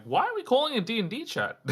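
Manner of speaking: high confused question. Retold or imitated. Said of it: "I probably would have been like".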